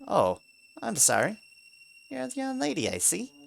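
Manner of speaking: bow and apologize meekly; a lightly voiced timid mouse; apologetic mouse. A narrator's reading.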